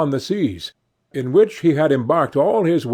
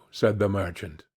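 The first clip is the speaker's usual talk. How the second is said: ordinary delivery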